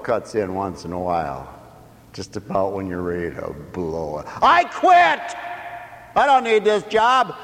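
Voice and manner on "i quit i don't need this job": high, loud furious quitting shout; angry outburst. Acted. Worker shouting at the boss that he quits. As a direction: blow up and quit. Dumber self about to blow it.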